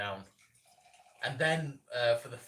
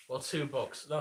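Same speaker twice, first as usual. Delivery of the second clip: ordinary delivery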